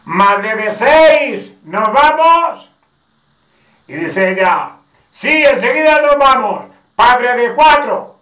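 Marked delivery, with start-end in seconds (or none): 0.1-2.7 slow, drawn-out shout pitched high; calling across the room. Retold or imitated. Husband shouting the nickname across the wedding to his wife. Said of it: "le dice él desde largo, para que lo oyeran". 5.1-8.2 high, loud, falsely sweet reply; sarcastic comeback. Retold or imitated. Wife answering her husband across the wedding, loud and sugary.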